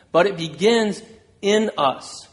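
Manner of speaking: ordinary talk, nothing marked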